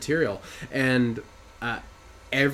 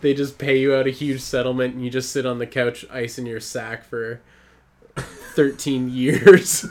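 words broken by laughter; laughing; 2 semitones higher, 6 dB louder than usual. Real, not acted. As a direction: laugh while you talk. Laughing through his own joke.

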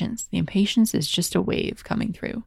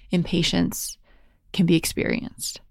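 ordinary talk, nothing marked

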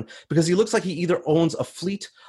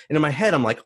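plain reading voice

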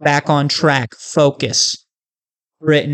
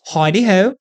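higher-pitched, badly faked British accent; failed British accent; 5 semitones higher than usual. Shown as a demonstration. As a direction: try a jaunty British accent and miss. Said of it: "No, see, I got nothing".

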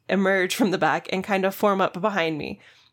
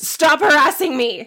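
loud, slow, higher shriek; screaming; 7 semitones higher, 7 dB louder, slower than usual. Retold or imitated. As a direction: scream it at the cashier. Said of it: "She screams".

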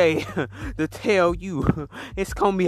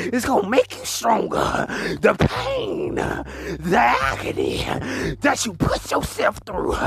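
fiery preaching; 3 semitones higher than usual. Acted. Preaches with rising fervour.

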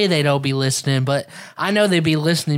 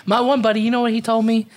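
ordinary delivery

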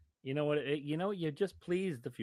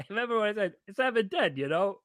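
laughing; 9 semitones higher, 7 dB louder than usual. Real, not acted. Laughing at own date mistake.

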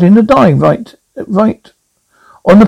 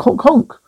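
goose honk imitation; 6 semitones higher, 4 dB quieter than usual. Shown as a demonstration. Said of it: "geese go honk".

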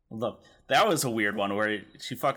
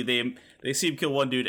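ordinary delivery

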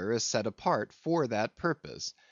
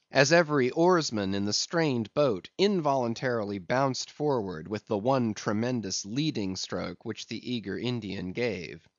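ordinary delivery, nothing marked

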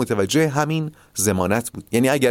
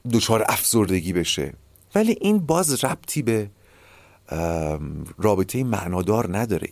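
ordinary talk, nothing marked

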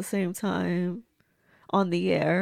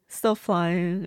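normal talking voice

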